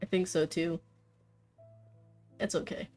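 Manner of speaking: ordinary talk, nothing marked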